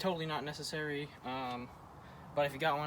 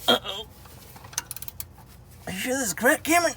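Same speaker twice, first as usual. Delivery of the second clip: worried surprise; 10 semitones higher, 9 dB louder than usual. Real, not acted. Bolt trouble, anxious question.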